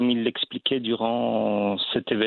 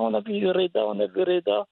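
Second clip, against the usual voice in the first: much higher, thrilled voice of relatives calling; excited relatives; 9 semitones higher than usual. Retold or imitated. Relatives from the bled phoning about seeing him on TV, much higher and thrilled. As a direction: squeal it like family bursting with pride on the phone.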